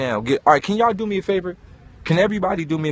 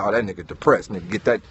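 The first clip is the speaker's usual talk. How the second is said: wary neighbor; 6 semitones lower, 3 dB quieter than usual. Retold or imitated.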